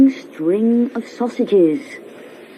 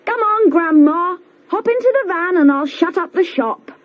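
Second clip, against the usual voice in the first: higher, quick cheerful dad; dad hurrying; 10 semitones higher, faster than usual. A narrator's reading. Dad urging Grandma into the van, higher and fast. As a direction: hurry Grandma along brightly. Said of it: "said their dad".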